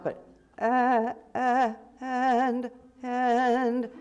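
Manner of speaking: "and" started with a hard catch in the throat; hard glottal onset. Shown as a demonstration. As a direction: attack "and" with a throaty glottal stop. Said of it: "we're not starting an and here in the mouth".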